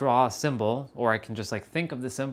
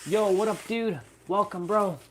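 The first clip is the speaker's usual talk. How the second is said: excited greeting; 8 semitones higher than usual. Real, not acted. Greeting a viewer joining.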